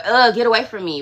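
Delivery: irritable brush-off. Retold or imitated. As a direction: imitate her grumpy self pushing people off. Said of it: "Why am I like".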